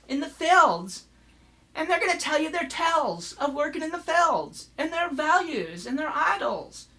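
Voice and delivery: high-pitched mocking Valley Girl politician; Sarah Palin. Mocking a politician's stump speech, high lazy-vowelled accent. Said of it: "that's Sarah Palin's accent".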